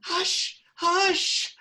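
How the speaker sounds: high urgent hushing. Acted. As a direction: hush them in alarm. Warning of police.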